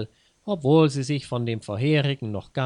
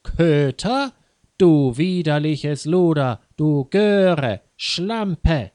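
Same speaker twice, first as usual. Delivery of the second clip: loud furious string of insults; acted screaming tirade; enraged shout; 4 semitones higher, 7 dB louder, slower than usual. A narrator's reading. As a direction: shout each insult, beside yourself with rage. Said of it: "schrie Frau Xavier wutentbrannt".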